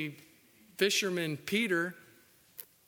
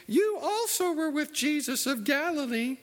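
high mocking girl's voice, hammed up; preacher's falsetto servant girl; 10 semitones higher than usual. Voices servant girl accusing Peter.

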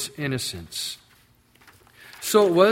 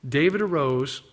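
normal talking voice

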